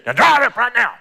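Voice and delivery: high, loud mocking shout of a harsh parent; mock parent yell. Preacher mocks parent yelling at crying kid, loud mock shout. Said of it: "then say".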